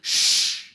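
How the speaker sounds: sharp unvoiced shh to silence the room; hushing shh. Little sister silencing screaming parents, unvoiced shh. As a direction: hush everyone with one shh. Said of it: "she goes".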